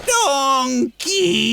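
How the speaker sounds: high, strained groaning cry of the name; pained groan. A narrator's reading. Shrek kicked by Donkey, groaning his name. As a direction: groan it, winded by the kick. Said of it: "he groaned".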